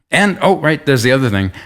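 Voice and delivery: high, mock sudden remembering; sarcastic. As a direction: feign a sudden afterthought, sarcastically. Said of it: "sarcastic jerk here".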